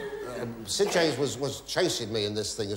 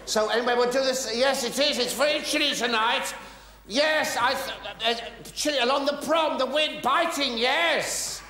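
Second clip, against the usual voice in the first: raised polite small-talk voice; lightly voiced chatty small talk; 10 semitones higher than usual. Comedian making small talk with the pianist, raised and polite. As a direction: chat brightly to someone who can't hear.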